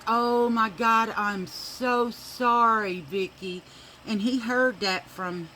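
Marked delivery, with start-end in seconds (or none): 0.1-3.6 slow, loud embarrassed apology; mother apologizing for her son's cussing; mortified apology. Retold or imitated. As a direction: drag out the sorry in shame. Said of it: "I was like".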